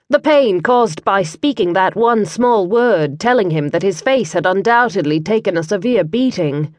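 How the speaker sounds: ordinary talk, nothing marked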